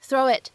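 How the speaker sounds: "throw it" joined as "throw-wit"; linked with glide. Shown as a demonstration. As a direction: slip a w glide between "throw" and "it". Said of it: "此时我们可以读成".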